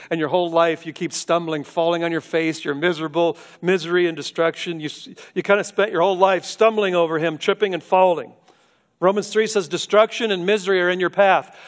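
loud, high, driving sermon climax; preaching at full force. Acted. Preacher warning the congregation, loud and rising. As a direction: pound it home from the pulpit.

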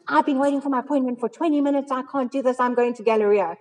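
imitating an impatient patient losing it; freaked out. Retelling the waiting-room lady's outburst, high and exasperated. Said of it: "this lady just freaked out".

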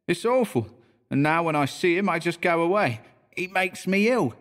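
Cockney bellboy. A narrator's reading. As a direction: tell it like a young London bellboy.

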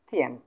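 short, separate model of থিয়ান; teacher demonstrating first-tone থিয়ান. Shown as a demonstration. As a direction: say থিয়ান cleanly as its own syllable. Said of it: "সম্পূর্ণ একটা first tone".

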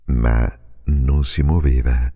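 usual speaking voice

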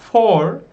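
for said loud and alone; stressed for. Shown as a demonstration. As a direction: punch for as the new word. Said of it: "for için demek".

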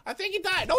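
ordinary delivery

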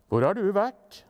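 lightly scolding, rising at the end; angrier. A narrator's reading. Father questions lillebror on his return, mildly angry. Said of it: "de bare ble sintere".

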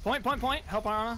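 shouted callout. Real, not acted. Urgent callout during fight.

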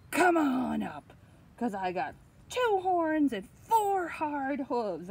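booming storybook dare; defiant big goat. Acted. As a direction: shout it out as the biggest goat. Biggest goat defies the troll.

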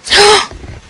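high startled gasp; a high frightened gasp; sharp gasp. Acted. Startled by a car.

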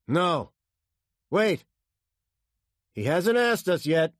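loud, high, forceful answer; shouted short instructions; urgent reply. A narrator's reading. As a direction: hold the pilot back.

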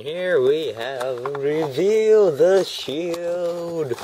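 high, loud, slowly drawn-out reveal; grand announcer. Pretend. Vlogger presenting a toy line to viewers, grand announcement. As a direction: stretch each word into a big fanfare.

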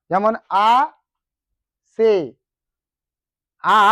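আ, সে said as separate beats; syllables split apart. Shown as a demonstration. Teacher splitting আসে into syllables for students. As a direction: break আসে into আ and সে with pauses. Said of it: "বলতে চাইছে".

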